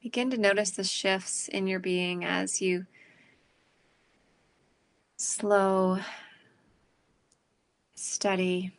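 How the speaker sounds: calm, unhurried breathing guidance; guided meditation. Acted. Leads a meditation.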